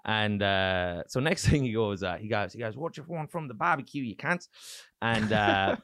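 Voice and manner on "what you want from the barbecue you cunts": higher-pitched mock Aussie; Australian accent. Comic imitating Colin serving barbecue, broad Australian accent. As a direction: offer it as a crude Australian host. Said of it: "I'm doing this Australian accent".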